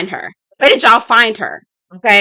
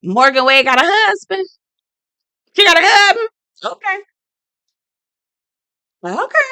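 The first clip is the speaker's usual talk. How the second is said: surprised; 10 semitones higher than usual. Retold or imitated. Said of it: "I said".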